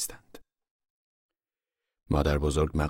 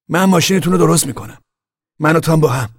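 much higher and louder, eager to help; eager offer; 11 semitones higher, 10 dB louder than usual. Acted. Al offering to fix Wilson's car, eager and much louder. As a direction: jump in keen to fix the car.

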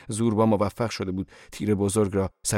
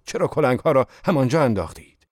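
higher, louder angry scolding; 5 semitones higher, 6 dB louder than usual. A narrator's reading. Zorba scolding workers for leaving the picks, angry. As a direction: scold them hotly. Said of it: "زوربا خشمگین به کارگران گفت".

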